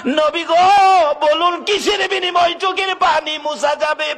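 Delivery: high, long-drawn impassioned call. Acted. Usman announcing himself to the weeping Prophet, calling out. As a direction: call out high, stretching the first word. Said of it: "Usman Gani ডাকতেছে".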